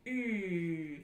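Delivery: ü held long as a lip-rounded E; rounded E vowel. Shown as a demonstration. Teacher introducing the next German vowel, long rounded ü. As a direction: say ü as a tense, rounded "ee". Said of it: "this is the rounded version of E".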